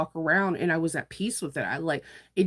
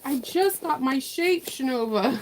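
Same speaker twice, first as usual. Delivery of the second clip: higher, lightly voiced indignant bag; the bag talking; 7 semitones higher than usual. Bag protests to its maker, mock indignant. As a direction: give the bag a small offended voice. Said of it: "And the bag's like".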